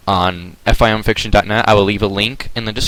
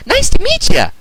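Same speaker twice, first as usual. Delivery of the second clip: bubbly Pinkie; 5 dB louder than usual. Acted. Pinkie hugging the creature.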